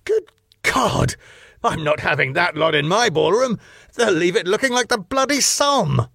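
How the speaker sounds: put-on high, huffy, outraged old boss; pompous club owner. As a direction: play him as a scandalised snob. Max voiced as pompous owner.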